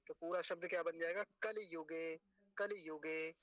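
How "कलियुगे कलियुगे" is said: "कलियुगे" with a clear short इ after ल; audible इ demo. Shown as a demonstration. Teacher building the full word, audible इ. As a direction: let the इ sound in "कलियुगे". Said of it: "इकार की आवाज भी आनी चाहिए".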